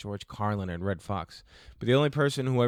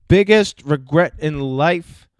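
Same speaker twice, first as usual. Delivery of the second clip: loud question read; 5 semitones higher, 14 dB louder, slower than usual. Pretend. Reading question in mock voice.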